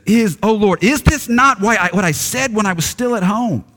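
a hint of a sulking prophet crying out; angry Jonah praying. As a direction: lift into a sung, complaining prayer. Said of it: "this is why he's angry".

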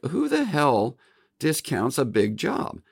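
mock outrage at the boss's idea; incredulous retort. Retold argument with his boss.

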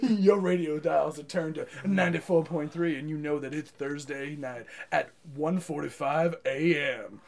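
low, soft late-night radio voice; seventies announcer. Pretend. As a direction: croon it like a seventies DJ. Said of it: "like that announcer guy".

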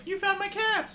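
put-on gleeful little kid voice; high child voice. Kid thanks Cloud for cats.

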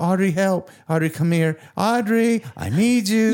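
calling for help. Retold or imitated. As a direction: call out to your wife from bed in pain. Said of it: "And I'm just calling".

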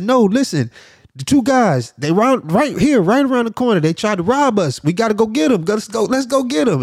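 high-pitched, breathless-paced insistence; urgent rush, voice pushed way up; frantic urging. Retold or imitated. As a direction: re-enact a desperate plea to police. Acts out urging police to chase.

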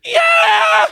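high, loud vocal imitation of the Netflix logo sound. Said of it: "sounds like this".

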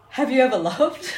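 laughing while echoing the shout; repeating the shout. Retold or imitated. Comedian repeating the audience question, laughing. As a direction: quote it back through laughter. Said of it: "he heard it like that".

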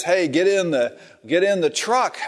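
high urgent call from a truck. Retold or imitated. Man in truck urging woman to get in, high calling out.

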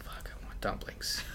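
hushed longing whisper. Real, not acted. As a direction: whisper it like a craving. Whispered craving.

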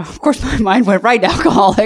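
laughing out loud while confessing. Real, not acted. Guest laughing at herself to the hosts, laughing. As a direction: let a real laugh break through the line. Admits her mind went to alcohol.

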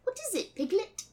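put-on soft, high British boy voice; little British boy accent. Christopher Robin greets Piglet in a little British boy accent. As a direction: answer lightly as a small English boy. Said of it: "your little British boy accent".

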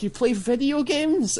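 slow, high-pitched incredulous question; disbelief. Retold or imitated. Repeating the lab supervisor's question about his hobby, incredulous. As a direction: mimic astonishment, stretching it out. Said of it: "the sound of disbelief in his voice".

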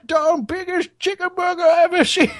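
put-on high old codger voice; hammed-up grandpa brag; mock old man. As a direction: boast it like an old-timer.

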